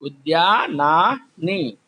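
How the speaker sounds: उद्यानानी said slowly, each syllable clear; teacher drilling a Sanskrit plural; slow clear recital. Shown as a demonstration. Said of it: "याद रखना".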